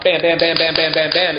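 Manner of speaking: quick, even burst of bams; machine-gun volley of words; rapid-fire gunshots. Retold or imitated. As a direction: rattle them off like gunfire. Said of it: "like rapid fire gunshot".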